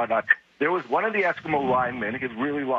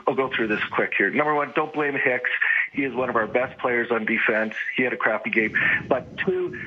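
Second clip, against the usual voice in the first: ordinary talk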